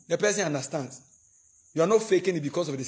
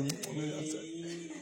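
mock apology; 12 dB quieter, slower than usual. Acted apology to spouse.

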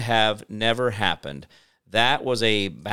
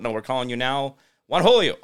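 ordinary talk

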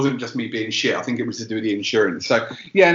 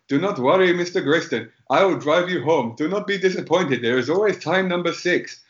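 put-on higher-pitched Indian English accent, careful and polite; 5 semitones higher than usual. Driving instructor consoling the pupil in an Indian accent. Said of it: "I don't wanna do the Indian accent".

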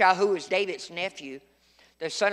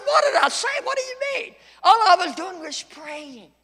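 much higher, startled shout; shocked outcry; 9 semitones higher than usual. Retold or imitated. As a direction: yelp it in disbelief. Retold shocked reply after punch.

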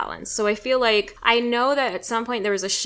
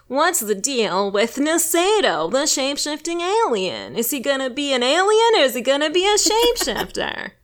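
Jerry Seinfeld impression; 5 semitones higher, 4 dB louder than usual. Podcast host mocking a sci-fi plot as Seinfeld. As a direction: ham up a Seinfeld observational joke. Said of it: "my Jerry Seinfeld impression".

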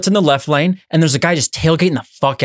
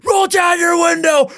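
high, slow bellowed command; yelling order; 12 semitones higher, slower than usual. Retold or imitated. Road rager yelling at driver, slow high shout. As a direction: bark it at him through the window.

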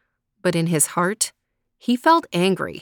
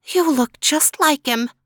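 put-on old woman voice; 8 semitones higher, 3 dB louder than usual. Old woman speaks to hunter.

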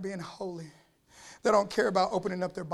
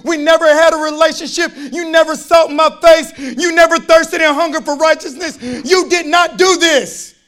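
loud, slowing preacher's cry, driving each line home; full-force preaching; 8 semitones higher, 19 dB louder than usual. Acted. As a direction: thunder it from the pulpit.

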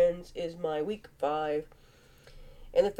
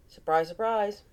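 usual speaking voice

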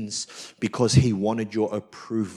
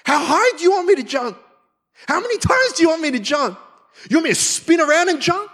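much higher, louder eager questions; eager devotion; 5 dB louder, faster than usual. Retold or imitated. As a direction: bounce with keen readiness to obey. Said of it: "a heart that loves God".

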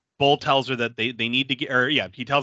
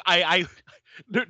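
normal talking voice

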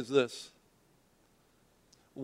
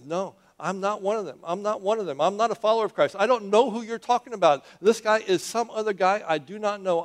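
higher voice, building louder in protest; vehement denial; 5 semitones higher, 4 dB louder than usual. Acted. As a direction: deny it over and over, getting more forceful. Acts Peter's escalating repeated denial.

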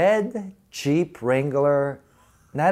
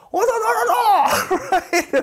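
much higher, slow laughing "no, no"; mock dismay; 5 dB louder, slower than usual. The cast as reality crashes their joy, high slow laughing dismay. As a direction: wail it out, half laughing at the letdown.

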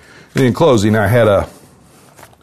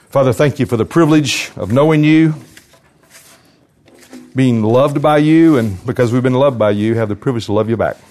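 raised, heartfelt public prayer; earnest prayer; 2 semitones higher than usual. Real, not acted. Preacher thanking the Father before the congregation, earnest prayer.

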